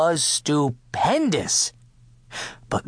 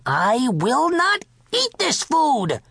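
slow, high-pitched defiant king; indignant refusal; 10 semitones higher than usual. A narrator's reading. King refusing the cook's food, indignantly. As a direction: refuse it imperiously, drawing the words out high.

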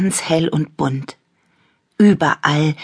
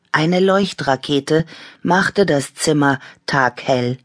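usual speaking voice

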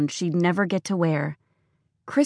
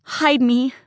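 frightened plea; 6 semitones higher than usual. Acted. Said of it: "she cried".

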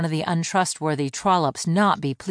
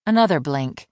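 ordinary delivery, nothing marked

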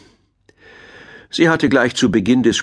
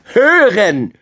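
put-on high, loud child's yell; cheeky shout; 11 semitones higher, 5 dB louder than usual. A narrator's reading. Cheeky grandson repeats it for grandmother badger, high loud shout. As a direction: yell it right into granny's ear. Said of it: "schrie der freche Kleine ihr ins Ohr".

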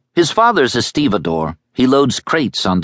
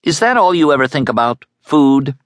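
annoyed; 8 semitones higher than usual. A narrator's reading. Boy losing patience with the girl's questions, annoyed and fast. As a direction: snap at her impatiently. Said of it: "He shifted suddenly, in annoyance".

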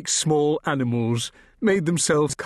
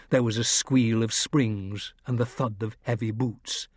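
ordinary delivery, nothing marked

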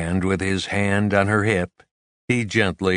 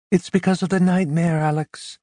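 high, soothing female voice; woman's voice; 9 semitones higher than usual. A narrator's reading. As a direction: explain it to him kindly. Carmen voiced.

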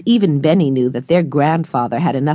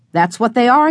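cheerful agreement; 7 semitones higher, 3 dB louder than usual. A narrator's reading. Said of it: "said Henry".

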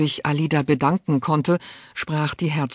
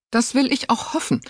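higher, sharp retort; 10 semitones higher than usual. A narrator's reading. Duchess retorting sharply to her niece, cutting. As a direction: cut her off coldly. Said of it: "entgegnete die Herzogin scharf".

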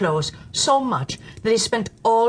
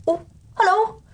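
chirpy greeting; 12 semitones higher than usual. Acted. Storyteller greets in a character voice.

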